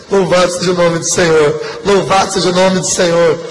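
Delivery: repeated full-voiced praise chant; preaching praise. Real, not acted. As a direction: proclaim God's name to the church. Preacher's triple praise refrain.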